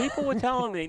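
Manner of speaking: high-pitched put-on grievance; imitating a complainer. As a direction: mimic a friend calling up to complain. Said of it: "No, he's gonna say".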